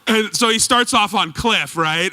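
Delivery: ordinary talk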